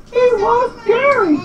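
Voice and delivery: very high put-on squeak; slow, high-pitched cartoon voice; Sandy. A narrator's reading. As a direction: squeak it out in falsetto. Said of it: "Sandy said".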